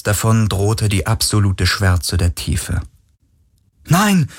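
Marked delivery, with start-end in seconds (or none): 3.9-4.3 high, pleading no; desperate cry. Acted. Galen cries out to his dying friend, desperate. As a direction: cry out as a friend slips away. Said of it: "rief Galen".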